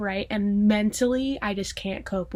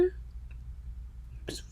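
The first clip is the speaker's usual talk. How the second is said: whisper; 15 dB quieter than usual. Real, not acted. Whispers reaction to the episode.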